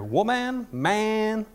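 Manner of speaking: high, stretched-out hammed-up exclamations; seventies Peter. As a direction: play up a seventies cool-guy voice. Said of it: "it's just like the seventies Peter".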